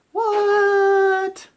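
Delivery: very high, loud, long squealed disbelief; drawn-out comic shriek; shocked outcry. Retold or imitated. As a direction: imitate a whole table in outraged shock. Players' retold shock.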